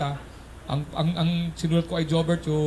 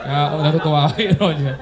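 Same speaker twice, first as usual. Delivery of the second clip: laughing; 7 dB louder than usual. Real, not acted. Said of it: "laughing while praising Roger".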